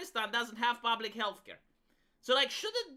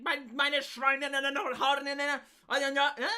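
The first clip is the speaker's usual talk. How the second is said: mock German dictator's rant, loud and raised; Hitler; 4 semitones higher, 4 dB louder than usual. Streamer mimicking the politician's cadence as Hitler, mock ranting. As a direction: bark out gibberish German like a ranting orator. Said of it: "isn't it Hitler-esque?".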